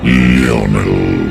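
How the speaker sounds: usual speaking voice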